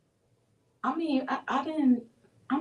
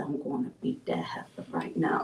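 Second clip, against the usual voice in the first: whisper; 4 semitones lower, 7 dB quieter than usual. Retold or imitated. Retelling angry threat.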